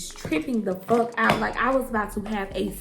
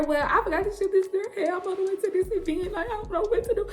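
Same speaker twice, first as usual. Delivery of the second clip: high, half-laughing panicked voice; 9 semitones higher, faster than usual. Retold or imitated. Telling mom about the forgotten order, high laughing panic. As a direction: squeak it out, laughing at her own panic. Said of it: "I started telling her".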